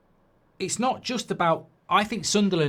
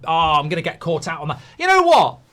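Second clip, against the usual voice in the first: ordinary talk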